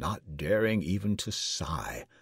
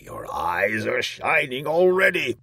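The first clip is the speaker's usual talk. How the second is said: raised, louder gloating tyrant; gloating king; 7 semitones higher, 6 dB louder than usual. A narrator's reading. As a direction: crow over the drinker as king. King mocks the drinker.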